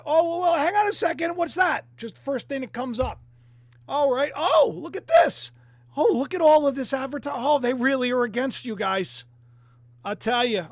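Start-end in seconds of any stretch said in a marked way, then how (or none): 0.0-1.9 high, loud mock surprise. Vlogger spotting a search result, hammed-up surprise. 3.9-9.2 high, loud sarcastic gushing; mock amazement at all the ads; sarcastic glee. Vlogger mocking Ben Shapiro's group, loud sarcasm. Sarcastic reading of search results.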